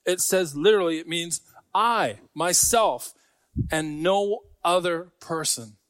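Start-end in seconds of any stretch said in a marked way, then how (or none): 1.7-5.8 slow, each word weighed, stress on "I, myself"; slow emphatic I. Shown as a demonstration. Preacher to congregation explaining the Greek, slow emphatic stress. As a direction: lean hard on "I, myself" and draw it out. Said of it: "it is an emphatic".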